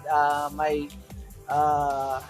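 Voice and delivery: usual speaking voice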